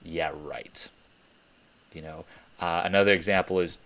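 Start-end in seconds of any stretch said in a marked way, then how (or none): 0.0-0.9 lower, softer sarcastic scoff; the doubting inner voice mocking you. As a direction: dismiss it with contempt. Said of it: "that little voice inside your head".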